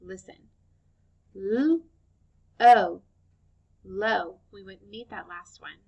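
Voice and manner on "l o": L-O chopped apart, louder; karate chopped sounds. Shown as a demonstration. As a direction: chop low into its sounds. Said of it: "karate chopping the word low, listen".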